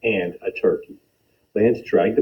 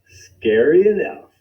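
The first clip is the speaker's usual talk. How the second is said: pleased; 9 semitones higher, 4 dB louder, slower than usual. Acted. Lance pleased with result.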